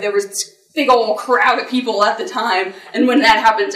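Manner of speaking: usual speaking voice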